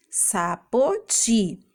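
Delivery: SAPOTI slowly, sa-po-ti; syllable by syllable. Shown as a demonstration. Teacher revealing the completed fruit, slow sa-po-ti for SAPOTI. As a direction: stretch and separate each syllable of SAPOTI. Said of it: "complete os nomes de frutas com as sílabas".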